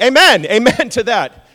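loud laughing amen from the crowd; mock congregation, laughing and loud. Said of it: "And you're just like".

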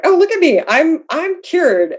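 high, singing-out naive boast; mock self-congratulation. Retells her naive early self-congratulation.

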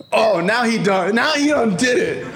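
high, laughing, hammed-up shout; defiant mock shout. A son yelling defiance at his father, high and laughing. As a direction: yell it back like a cocky teen.

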